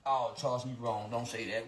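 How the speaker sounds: mock self-scolding.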